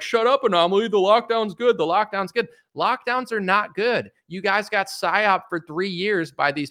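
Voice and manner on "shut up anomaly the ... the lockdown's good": mocking lockdown fans. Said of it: "they're like".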